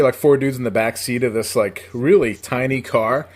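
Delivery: normal talking voice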